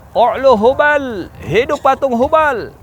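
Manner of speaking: high, drawn-out shout; war cry. Acted. Abu Sufyan to the enemy, long high triumphant shout. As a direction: bellow praise of the idol. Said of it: "Abu Sufyan kemudian teriak".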